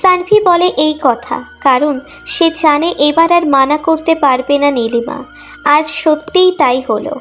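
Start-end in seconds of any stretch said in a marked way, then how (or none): none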